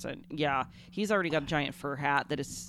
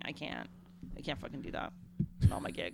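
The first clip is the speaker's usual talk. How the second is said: an unvoiced, much softer hush; whispered refusal; 10 dB quieter than usual. Real, not acted. Friend whispering a firm refusal to go camping.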